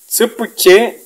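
normal talking voice

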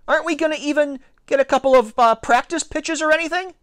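high-pitched, fast protest; yelled back. A narrator's reading. Alex wanting practice pitches, yelled back. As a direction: yell a high, rushed complaint. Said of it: "I yelled back".

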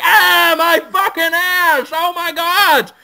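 high, loud scream of pain. Acted. Brendan Fraser screaming as hands pull him apart, in pain. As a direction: shriek as if being torn apart. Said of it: "Brendan Fraser's like".

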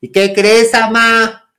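high, loud call to mom; imitating herself yelling out; retold shouting. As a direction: shout for your mother's attention with big news. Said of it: "Así yo".

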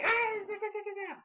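high, mock yelling nonsense syllables; mocking the Bellas' shouting. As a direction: parody their annoying chant. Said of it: "with them shout like".

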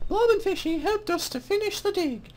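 high-pitched mock British woman; female-voiced British accent. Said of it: "my horrible female voiced British accent".

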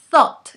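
"Thought" with air pushed out between the teeth; voiceless th. Shown as a demonstration. Teacher exaggerating the breathy th for students. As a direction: blow air over the tongue for "Thought". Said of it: "Se trata de sacar aire".

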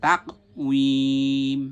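তাকউইম, slow, drawn out on one steady note; Quranic recitation. Shown as a demonstration. Teacher recalls a word from Surah Tin, reciting it drawn out. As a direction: recite তাকউইম with the final syllable held long. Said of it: "ওই যে সূরা তিনে আছে কি".

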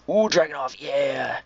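gasp, then high thrilled find; excited gasp. Real, not acted. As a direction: sound delighted at the loot. Finds a Dragunov.

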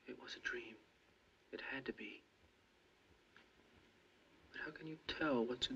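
whispering. Acted. Whispered reassurance.

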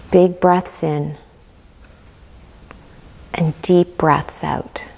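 calm guided meditation with long pauses. Acted. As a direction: guide them gently into relaxation, leaving room to breathe.

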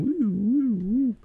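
high, wavering "woo" sound effect; imitated UFO hum. Storyteller showing the orb moving, imitated eerie wooing. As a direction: sing out an eerie high flying-saucer whoop. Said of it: "it's just going".

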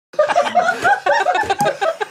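very high, loud laughing cackle; shrieking high laughter. Pretend. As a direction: let out a piercing, rapid cackle.

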